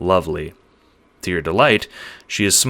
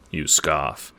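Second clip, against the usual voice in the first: ordinary delivery, nothing marked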